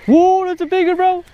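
high thrilled shout; excited. Real, not acted. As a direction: gasp at the size of it. Friend hooks big fish.

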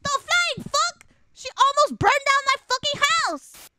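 high, loud indignation; outraged outburst. Retold or imitated. Sibling complaining sister nearly burned the house, outraged outburst. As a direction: burst out in high-pitched outrage.

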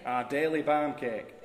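slow, loud Yorkshire intoning; Yorkshire accent. Acted. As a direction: draw it out in broad Yorkshire. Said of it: "In a Yorkshire accent".

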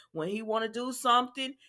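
ordinary talk, nothing marked

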